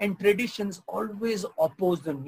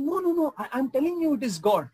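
insistent auntie; 7 semitones higher than usual. Retold or imitated. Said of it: "She said".

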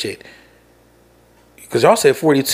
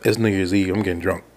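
resigned giving up; 4 semitones lower, 6 dB quieter than usual. Retold or imitated. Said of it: "I'm like".

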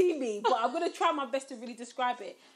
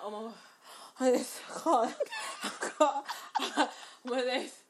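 laughing through a mock groan; laughing, mock dying. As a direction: laugh while playing sick. Retold sickness, laughing.

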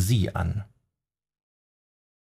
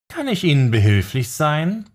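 hoch und übertrieben süßlich gefragt; Nervosität mit falscher Freundlichkeit überspielen; zuckersüß; 7 semitones higher, 3 dB louder than usual. A narrator's reading. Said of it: "fragte er zuckersüß". (zuckersüße Frage an den Verfolger)

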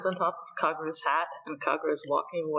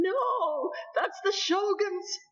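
much higher, slow, crying wail; mock wailing sob; 12 semitones higher, much slower than usual. Kondo wails as the beetle is hit, mock crying. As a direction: blubber like a heartbroken man. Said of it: "tears running down his eyes and he's yelling".

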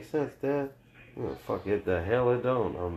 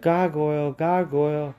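loud, higher mock call; mom calling out; 5 semitones higher, 4 dB louder than usual. Mom calling a waiter names, loud mock call. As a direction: call across the restaurant like mom. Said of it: "She'd be like".

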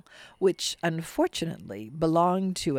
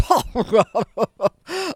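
loud proud belly laugh; booming ogre laugh; 5 semitones higher, 6 dB louder than usual. Acted. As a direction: roar with laughter like a giant. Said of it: "The ogre bellowed".

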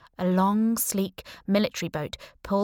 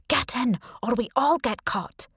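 put-on higher, insistent young man; urgent command; 6 semitones higher than usual. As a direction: press her firmly.

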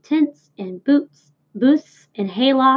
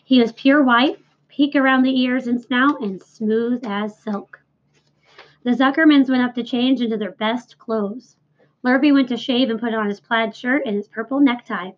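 usual speaking voice

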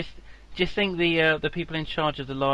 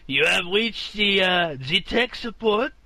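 put-on loud Indian accent drifting into French; botched Indian accent; 6 semitones higher, 4 dB louder than usual. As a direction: play Indian tech support with a slow, high, badly faked accent. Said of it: "sound like you're in France".

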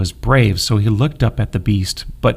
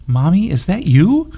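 sleepy; 7 semitones higher than usual. A narrator's reading. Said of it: "asked sleepily".

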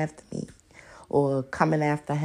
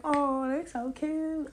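mock gushing; 8 semitones higher, 5 dB quieter, slower than usual. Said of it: "people gonna be like".

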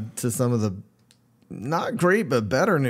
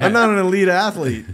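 repeated through real laughter, higher and louder; laughing; 7 semitones higher, 6 dB louder than usual. Real, not acted. Teased by co-host, he repeats the line laughing. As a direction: laugh it out, defending yourself.

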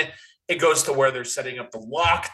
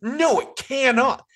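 higher and louder, no no it can't; emphatic denial; 8 semitones higher, 4 dB louder, slower than usual. Real, not acted. Host arguing back at the FBI agent, emphatic denial. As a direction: flatly refuse the agent's claim, louder.

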